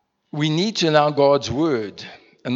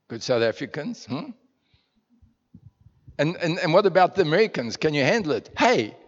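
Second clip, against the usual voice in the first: ordinary talk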